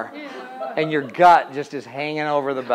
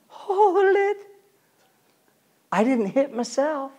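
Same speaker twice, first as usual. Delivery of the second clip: high, defensive protest; mock-offended squeal; indignant driver; 11 semitones higher, 3 dB quieter, slower than usual. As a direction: play the indignant victim.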